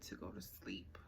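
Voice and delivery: soft whisper on the last word. A narrator's reading. Reader hushing the word for sleepy children, whispered. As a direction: hush it like a bedtime story. Bedtime whisper in read-aloud.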